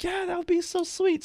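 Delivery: very high, gushy mimicked voice; gushing manager imitation. Manager approves his thank-you idea, mock gushing.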